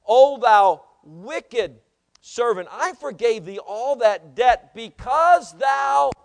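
loud, slow, drawn-out rebuke from scripture; thundered scripture reading. Acted. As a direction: proclaim the master's rebuke from the pulpit. Reads the lord's rebuke aloud.

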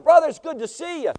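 high, loud acted welcome; hearty greeting. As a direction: hail an old friend with gusto. Acts out greeting with hug.

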